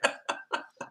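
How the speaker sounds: soft, unvoiced-sounding real chuckle; laugh. Real, not acted. Admitting she wasn't trying to be funny, quiet chuckle. Laughs at own live TV blunder.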